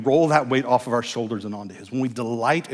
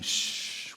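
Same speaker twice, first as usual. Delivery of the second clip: whispered shh; soft shush; 10 dB quieter than usual. Shown as a demonstration. Pastor demonstrating the baby shush. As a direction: hush gently. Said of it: "practice of saying".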